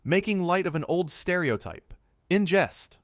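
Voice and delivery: high-pitched exclaimed protest; insistent, exasperated outburst; exclaiming. A narrator's reading. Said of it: "Jeremy exclaimed".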